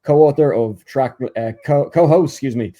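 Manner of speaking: usual speaking voice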